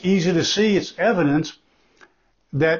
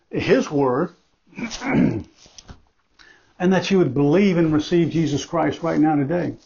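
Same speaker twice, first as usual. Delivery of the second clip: ordinary talk, nothing marked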